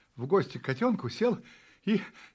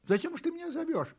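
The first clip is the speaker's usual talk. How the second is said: high, quick questioning; puzzled kitten; 12 semitones higher, faster than usual. A narrator's reading. Kitten demands why he is called. (высокий голос котёнка)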